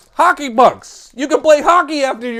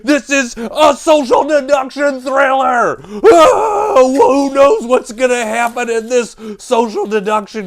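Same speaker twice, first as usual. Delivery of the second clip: loud, high, overdramatic trailer hype; hammed-up thrill; 5 semitones higher, 5 dB louder than usual. Host hyping a social deduction thriller, hammed-up thrill. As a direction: gasp and sell it as a huge thriller.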